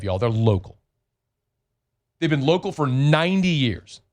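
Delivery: upbeat radio host pitching a sponsor; live ad read. Acted. Host reads the internet ad to listeners, upbeat and punchy. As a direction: sell it like a local radio spot.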